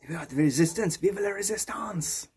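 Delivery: put-on French accent, last words stretched; mock rallying cry in a French accent. Joker cheering the Resistance Party, drawn-out French accent.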